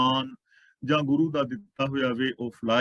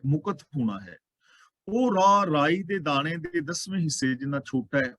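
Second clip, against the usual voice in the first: ordinary talk, nothing marked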